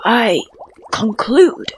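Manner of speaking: lightly voiced old fish, slow; a hint of an ancient fish, unhurried; slow deep old fish. A narrator's reading. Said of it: "in a slow, deep voice".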